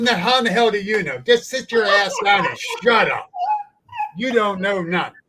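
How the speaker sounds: retold heated telling-off at a cookout; angry telling-off. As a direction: tell him to sit down and shut up. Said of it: "He had me fired up".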